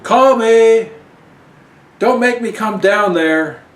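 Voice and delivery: high, loud shout across the beach; Gash calling out. Acted. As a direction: call after her from the shore.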